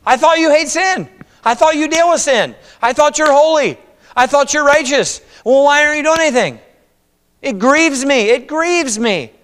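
high, loud anguished pleading; pleading complaint to God. Retold or imitated. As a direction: cry out to God in grief.

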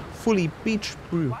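put-on slow, soft New Zealand accent; a hint of a Kiwi surfer drawl; lightly done New Zealand bro voice. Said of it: "That's my, uh, New Zealand accent".

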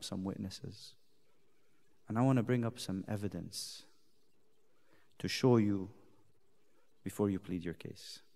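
a soft pulpit hush voicing God; hushed preaching. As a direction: draw them in, almost confiding.

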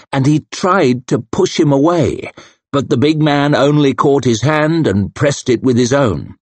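usual speaking voice

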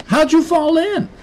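put-on very high child's voice; questioning kid. Kid asks Dad about the fall, questioning. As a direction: ask it high and puzzled.

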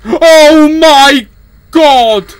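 loud shocked shout; startled yell. Real, not acted. Gamer yelling at a jump scare. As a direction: yelp it out in real fright.

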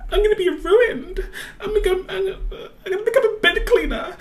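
high-pitched, tearful fretting; stammering, high panicky wail; panicked whine. Acted. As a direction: spiral into mock despair. Student panicking before her exam.

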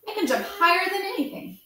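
a little rabbit fibbing bravely to impress; boastful little rabbit. A narrator's reading. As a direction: puff up and brag like a small toy. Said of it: "said the little rabbit".

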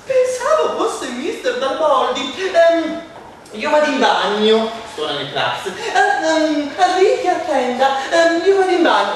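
high, loud fussing mum with ehm; flustered mother. Acted. Mother voiced in story. (mamma agitata, acuta e forte)

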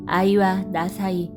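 plain reading voice

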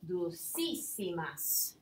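Dulcísimas drawn out slowly, every syllable clear, stress on the í; slow model word. Shown as a demonstration. Teacher slowly modelling the new word Dulcísimas for students. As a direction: say Dulcísimas slowly so the class hears the accented í. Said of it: "with an accent after the C on that first I".

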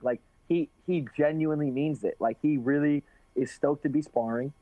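ordinary talk, nothing marked

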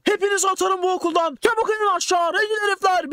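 high, scolding teacher shout; teacher scolding rioting students, mock furious shout; angry teacher yelling. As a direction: order everyone downstairs furiously. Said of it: "öğretmen bağırmaya başladı".

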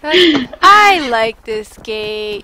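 very loud, drawn-out joke voice; silly put-on voice. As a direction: stretch every word out loudly in a silly character voice. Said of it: "Not to do that voice again".

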